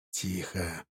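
low hushed warning. Acted. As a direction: keep it low, hiding. Hiding from bandits, hushing.

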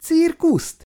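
shocked, stung repetition; indignant echo. A narrator's reading. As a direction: fling his word back at him, high and sharp. Indignant echo of his word.

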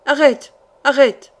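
loud, high shouted order; crowd's cry. A narrator's reading. Guards shouting after a runaway inside the house, urgent cry. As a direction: cry out to halt a fugitive. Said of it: "des voix qui criaient".

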